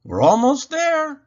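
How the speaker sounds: high, loud encouraging shout. A narrator's reading. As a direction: call up the stairs. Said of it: "I shout".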